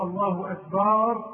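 "أكبر" with a long aa after the ب, "akbaar"; added alif. Shown as a demonstration. Teacher shows a meaning-changing addition, long final vowel. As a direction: stretch the last vowel of "أكبر". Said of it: "ألفا بعد الباء".

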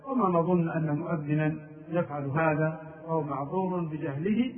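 usual speaking voice

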